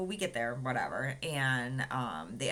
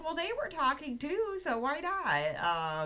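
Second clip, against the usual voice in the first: much higher, drawn-out so why not; playful justification; 13 semitones higher, 4 dB louder, slower than usual. Retold or imitated. Patient telling her laughing sister why she chatted, cheeky and high. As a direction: shrug it off with a cheeky lilt. Defending chatting during surgery.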